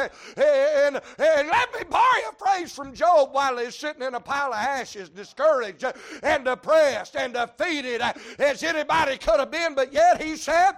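loud, high, hollered sermon climax; full-force preaching. Real, not acted. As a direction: raise the roof on the closing line.

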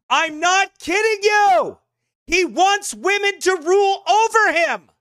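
high, loud, slow indignant yelling; outraged shout. Real, not acted. Preacher shouting at listeners about the pastor, outraged. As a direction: bellow it in disbelief at the congregation.

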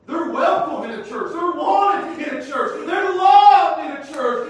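rising from loud to full shout; building shout. Real, not acted. Preacher building to a shout about children in church. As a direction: build each line bigger, slowing.

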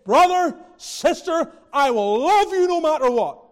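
loud, high, impassioned vow of love; fervent declaration. Acted. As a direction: proclaim it with full fervour. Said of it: "by saying".

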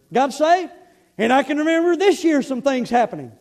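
loud, high-pitched revival preacher delivery; full-force preaching. Real, not acted. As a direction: proclaim the testimonies to the whole congregation at high volume.

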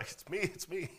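high-pitched, amused mock reply; re-enacted hasty reassurance. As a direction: mimic identifying yourself to someone spooked. Re-enacts reassuring the frightened man.

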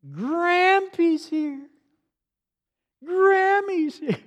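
put-on high, loud, drawn-out little-kid holler; hollering grandkids. Grandkids running to greet their grandparents, hollering. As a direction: imitate excited grandkids calling out. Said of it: "just hollering out".

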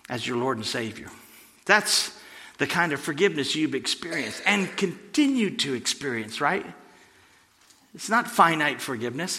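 ordinary talk

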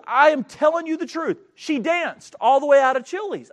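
ordinary talk

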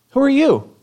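high-pitched disbelief; baffled question. Acted. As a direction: ask it as a bewildered old father. Confused father questions his son.

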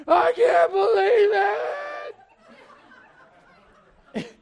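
loud, high, drawn-out comic wail; mock crying. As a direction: imitate a wife wailing in hammed-up sobs. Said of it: "crying, going".

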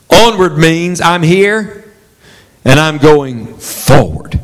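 spelled out word by word; each word placed slowly for the children; slow and deliberate. Shown as a demonstration. Explaining the word onward, very slow. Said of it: "Look here just a minute".

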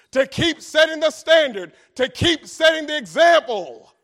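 loud, rising preacher's cry; preaching at full force. Real, not acted. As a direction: preach it out to the congregation.

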